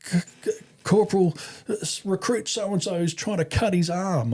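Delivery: mock stunned, halting high voice; stunned recruit. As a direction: stumble nervously into the report to a corporal. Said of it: "got this stunned look".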